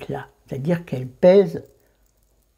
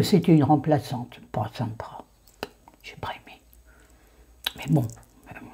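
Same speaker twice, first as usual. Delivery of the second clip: whispering; 3 dB quieter than usual. Real, not acted. Confiding about the unpleasant doctor.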